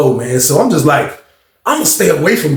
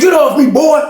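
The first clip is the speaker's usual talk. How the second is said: mock shove-off; 10 semitones higher than usual. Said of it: "Sean said".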